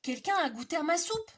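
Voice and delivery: indignant mama bear; middle bear voice. Acted. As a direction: middle bear upset about her soup. Middle bear speaks again.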